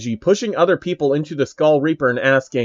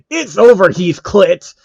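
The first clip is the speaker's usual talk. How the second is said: higher and louder, winning cry; triumphant shout; 5 semitones higher, 7 dB louder than usual. Acted. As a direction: shout as you land the final blow.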